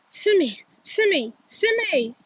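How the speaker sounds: high frantic calls of her name; crying out. Acted. Boy calling his dying friend's name, frantic. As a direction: call her desperately, again and again. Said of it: "he cries over and over".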